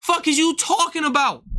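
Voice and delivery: retold dare to fight at the club; fired-up challenge. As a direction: square up and call him out. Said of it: "I'm like".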